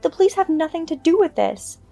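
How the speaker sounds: high, sharp furious threat; angry mother-in-law warning. Acted. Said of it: "considerably more furious".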